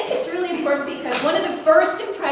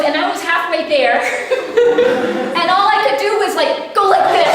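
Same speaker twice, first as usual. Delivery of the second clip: loud, higher, laughing hard while quoting; can barely get the words out for laughing; retold line broken up by laughter; laughing through the story; 7 semitones higher, 9 dB louder than usual. Retelling daughter's embarrassing greeting.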